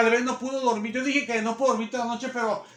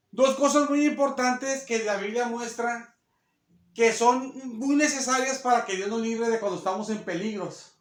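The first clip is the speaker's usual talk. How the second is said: ordinary talk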